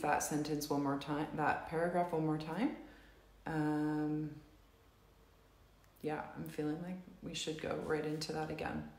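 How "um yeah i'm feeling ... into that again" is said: ordinary delivery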